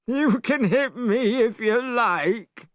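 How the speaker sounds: high, slow sulky whine; a high voice sulking after the spell half failed; complaining Pigsy. A narrator's reading. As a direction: sulk like a pig who tried his best. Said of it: "complained Pigsy".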